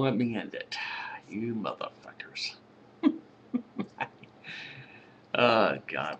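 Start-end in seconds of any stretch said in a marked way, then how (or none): 1.3-2.5 softer, whispered swearing; whispered curse. Real, not acted. Vlogger cursing the frozen video, whispered. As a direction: curse under your breath at the screen.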